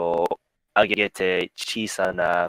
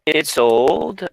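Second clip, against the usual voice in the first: normal talking voice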